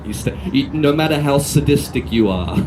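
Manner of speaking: ordinary talk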